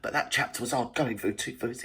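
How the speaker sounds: ordinary delivery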